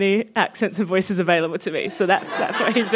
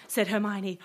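ordinary delivery, nothing marked

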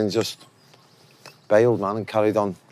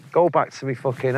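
ordinary talk

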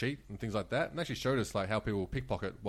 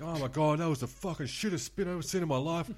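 mock outrage, raised pitch; higher-pitched indignant complaint, played for the story; retold outraged rant; 7 semitones higher than usual. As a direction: imitate an angry gambler kicking off. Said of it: "he's gone spastic".

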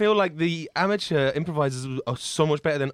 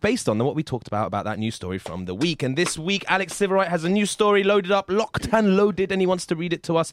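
radio host introducing a segment; show announcer. Acted.

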